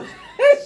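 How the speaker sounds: a burst of loud high laughter; a big delighted laugh at the story. Real, not acted. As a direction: crack up loudly. Laughs retelling joyful client.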